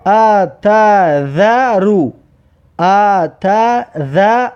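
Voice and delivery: اتذر drawn out as /ataðaru/; slow Arabic reading. Shown as a demonstration. Teacher drilling pupils on a Quran word, slow recitation. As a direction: sound out اتذر with every vowel. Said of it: "و اینجا".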